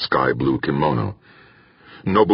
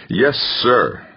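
fervent; 8 semitones higher than usual. A narrator's reading. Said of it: "Bracknell said fervently".

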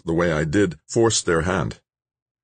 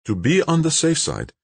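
lightly voiced Nobo, much higher; patient repeated explanation; 12 semitones higher than usual. A narrator's reading. Nobo instructing Dan on the device, patient repeated explanation. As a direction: explain it like a well-worn instruction. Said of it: "explained for the twelfth time".

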